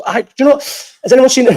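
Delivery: high, tumbling over the words; so eager the question won't come out; too excited to speak. Real, not acted. Streamer asking chat, bursting with excitement. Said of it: "I'm getting too excited to speak".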